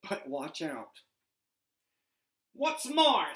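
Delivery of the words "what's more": loud, high, sharp retort; the Lorax's temper flares; snapping Lorax. Acted. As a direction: snap it out in anger. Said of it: "snapped the Lorax".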